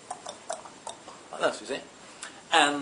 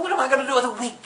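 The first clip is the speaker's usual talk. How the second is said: indignant prince; 10 semitones higher, much faster than usual. Acted.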